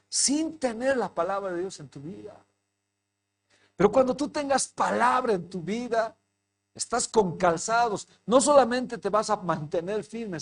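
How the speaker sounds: sermón ferviente, alto y fuerte; predicación a plena voz. Acted. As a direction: arenga a la congregación con fervor. Predica con fuerza. (predicación enérgica y alta)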